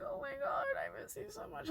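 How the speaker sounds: retold tearful whimper, very soft; emotional. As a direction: whimper it softly, as if tearing up. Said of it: "I got very emotional".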